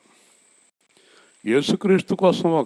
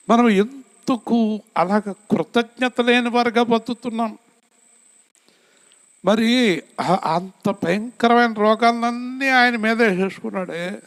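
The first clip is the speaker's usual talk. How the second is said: fervent preaching; 10 semitones higher than usual. Acted. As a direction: lift the congregation with a fervent cry. Preacher rising to full force.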